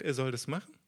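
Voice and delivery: normal talking voice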